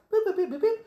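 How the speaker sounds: high-pitched robot boops; quick string of high boops; droid beeps. Retold or imitated. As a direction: imitate a little droid beeping. Imitates R2's droid beeps.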